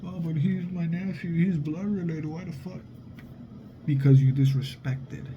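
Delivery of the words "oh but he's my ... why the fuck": higher whiny relative voice, fading at the end; mock protesting relative. Family defends the disrespectful nephew, mock whiny protest. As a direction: pitch it up like an outraged aunt. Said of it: "relatives defending nephew".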